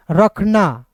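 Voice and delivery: ordinary delivery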